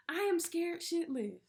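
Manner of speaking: pitched high, frightened plea; scared. Retold or imitated. Praying to God while crying, voice climbing high and scared. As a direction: cry out the fear to God. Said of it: "I'm like, God".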